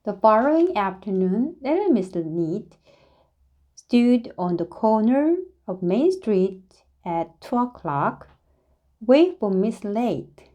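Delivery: usual speaking voice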